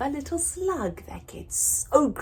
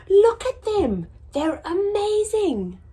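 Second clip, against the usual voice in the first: high, louder, thrilled; awed excitement; 13 semitones higher, 6 dB louder than usual. Acted. Slug admires snails, high and thrilled. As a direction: gasp at how amazing they are. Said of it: "said Norman".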